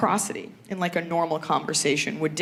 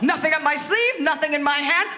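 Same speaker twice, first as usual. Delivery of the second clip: higher-pitched stage magician patter; mock magician, pitched up; magic act; 6 semitones higher than usual. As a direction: present it like a showy magic trick. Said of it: "like, a magic act".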